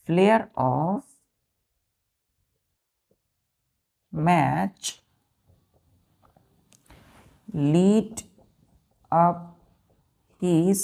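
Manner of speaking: very slow, long gaps between words; one word at a time, dropping on the last; word-by-word dictation. Shown as a demonstration. Dictating example sentence word by word.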